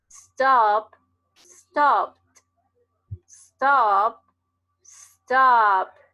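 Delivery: "stop" then "stopped" said loud, with a dry t at the end; loud model words. Shown as a demonstration. Teacher contrasting "stop" and "stopped" for the class, loud. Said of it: "este es seco".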